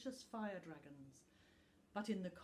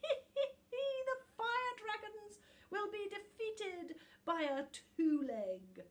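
high, slow, laughing prophecy; cackling sneer; 13 semitones higher, 6 dB louder, slower than usual. A narrator's reading. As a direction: laugh it out with scorn. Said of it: "sneered Book".

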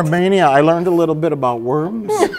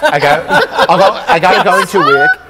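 put-on much higher New Zealand accent; 2 semitones higher than usual. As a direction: hit the high notes in a Kiwi accent. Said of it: "I hit the high notes".

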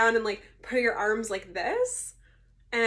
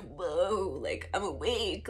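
slow, slightly lowered waking-up voice; mock groggy; 3 semitones lower, 4 dB quieter, much slower than usual. Patient telling someone she is awake, imitated groggy drawl. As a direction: drag the words out as if coming round.

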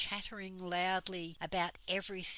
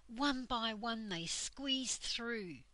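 ordinary delivery

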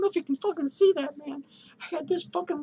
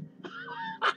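high squealing laugh; 11 dB quieter than usual. Real, not acted.

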